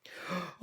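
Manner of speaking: high shocked intake of breath; sharp gasp. Acted. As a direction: gasp in fright. Ebenana gasps at the figure.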